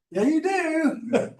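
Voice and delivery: high, drawn-out teasing comeback; mock retort. Alaskans answering the preacher about his accent, high drawn-out mock retort. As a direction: imitate a slow, very high-pitched contradiction.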